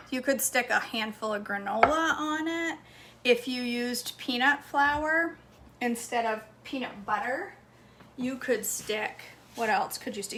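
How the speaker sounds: ordinary talk, nothing marked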